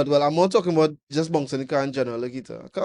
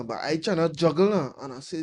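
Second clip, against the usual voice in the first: ordinary talk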